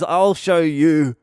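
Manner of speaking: a hint of a cocky drunk mate; mock taunt. Retelling the mate's boast before the fall, lightly voiced. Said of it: "he goes".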